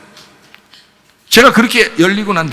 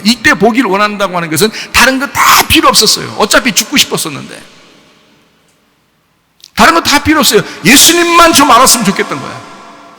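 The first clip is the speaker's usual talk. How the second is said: fiery preaching; 6 semitones higher than usual. Acted. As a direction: preach it out with full force. Preaching at full force. (힘차게 외치는 설교)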